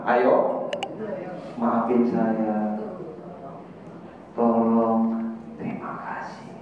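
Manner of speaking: low, very slow, fading to a whisper; gentle polite words. Shown as a demonstration. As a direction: say the three magic words tenderly. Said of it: "tiga kalimat kita pakai". (pelan sekali, lembut, hampir berbisik)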